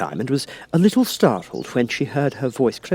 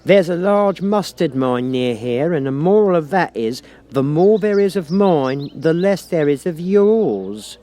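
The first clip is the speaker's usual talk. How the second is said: slow, higher, louder agreement; Duchess, louder, drawn out; 7 semitones higher, 5 dB louder, slower than usual. A narrator's reading. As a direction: pronounce it grandly. Duchess moralising.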